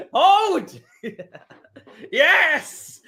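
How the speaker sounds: high, loud bursts broken by laughter; laughing celebration. Real, not acted. Fan gloating at rival fans, laughing and shouting. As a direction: cackle with glee between words.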